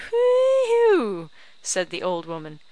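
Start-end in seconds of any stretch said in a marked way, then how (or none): 0.1-1.3 high, loud mocking whoop; scoffing whoop. A narrator's reading. Old woman jeers at the tenant's promise, shrill whoop. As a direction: whoop at the notion in mock delight.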